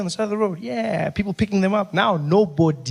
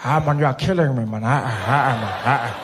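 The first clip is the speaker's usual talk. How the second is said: put-on old Mandela; 7 semitones lower than usual. Mandela voiced, exasperated.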